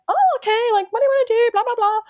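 very high, forced-cheerful chatter; trying hard, bubbly. Retold or imitated. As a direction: desperately keep the small talk afloat. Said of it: "really trying to, like, sti-, keep this conversation going".

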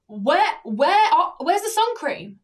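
loud, high, slowed-down demand; hammed-up pushy customer. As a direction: imitate an insistent shopper demanding help. Voices demanding customer in retold encounter.

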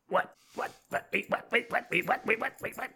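repeated flustered what, rising; hammed-up confused pig pilot; mock baffled what. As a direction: stammer out disbelief. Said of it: "Porco's like".

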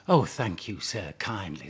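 very soft, sour thanks; mock the magistrate sweetly under your breath; mock polite. Mother to magistrate, soft sarcastic thanks.

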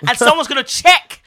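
firm and loud, not backing down; vexed demand. Retold or imitated. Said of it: "I was like".

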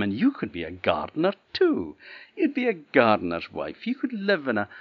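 sing-song taunting of a lovestruck sister; teasing sisters. Acted. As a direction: jeer at her like spiteful sisters. Sisters tease Lena about gardener.

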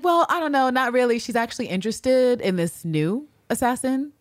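high, teasing feigned nonchalance; needling mock innocence. Constantine gaslighting Villanelle about a new assassin, mock-innocent. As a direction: innocently dangle it to wind her up. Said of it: "being a fucker with her and a gaslighter".

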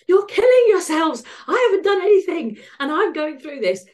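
high, loud angry shout at the smokers; angry outburst. Retold or imitated. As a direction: yell it at the smokers outside the hospital. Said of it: "I'd be like".

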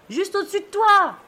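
high, drawn-out call from the mast; yelled from aloft. Acted. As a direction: stretch the warning down from above. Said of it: "me hurle de tout là-haut".

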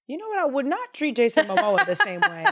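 high, giggly confession; laughing admission. Real, not acted. Host admits a celebrity crush to co-host, laughing. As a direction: break up laughing while owning up.

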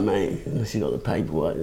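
low, soft deadpan; deadpan quip. Retold or imitated. Storyteller joking to the man on the ledge, low deadpan. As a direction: drop the joke dry and under the breath. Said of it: "I was like".

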